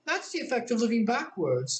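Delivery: a hint of a gentle queen; kindly Queen. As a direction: soothe her like a fussy queen. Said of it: "the Queen said kindly".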